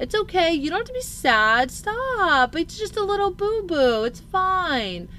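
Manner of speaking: high, singsong mock soothing; imitated cooing parent. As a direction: coo as if brushing off a toddler's tears. Mimics parent brushing off child's sadness.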